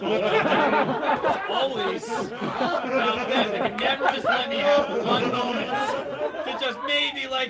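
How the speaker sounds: high, loud, slow rant broken by laughter; laughing rant. Real, not acted. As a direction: rant about them while cracking up. Comic ranting, laughing, loud.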